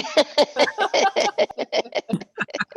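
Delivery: big high cackle at his own joke; high loud laugh. Real, not acted. Comic cracks up at own joke.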